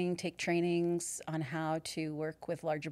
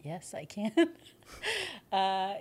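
usual speaking voice

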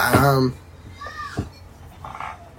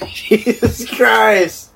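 laughing; 7 semitones higher, much slower than usual. Real, not acted. Laughing fit.

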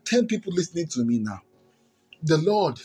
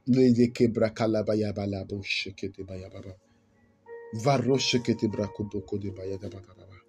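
praying in tongues; 5 semitones lower, 5 dB quieter than usual. Real, not acted.

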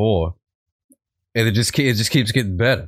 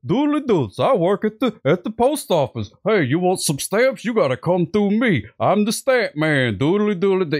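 much higher, slow, smug singsong clerk voice; impression of Robert; 10 semitones higher than usual. Cop mocks the post office clerk, high and smug. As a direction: ham up the self-important stamp man. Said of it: "I'm really good at that impression".

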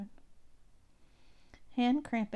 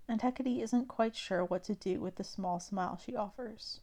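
ordinary delivery

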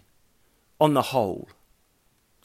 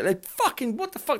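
mock outrage; 10 semitones higher than usual. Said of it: "you go".

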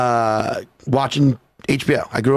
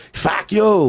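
put-on Boston accent; 5 semitones higher than usual. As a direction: curse like a caricature Bostonian. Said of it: "I think even a Boston accent".

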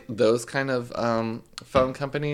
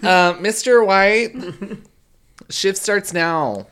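bossy manager voice; 10 semitones higher, 7 dB louder, slower than usual. Pretend. Young coworker calling old man.

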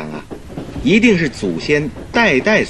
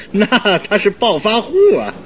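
high-pitched, laughing exclamation; tickled, voice lifted with laughter; amused laughing; 9 semitones higher than usual. Acted. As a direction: say it through a chuckle. Said through laughter, amused discovery.

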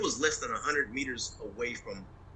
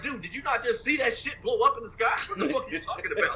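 much higher, outraged yell of disbelief; exasperated mock shout; 12 semitones higher than usual. To the host, retelling his outrage at the order: exasperated shout.

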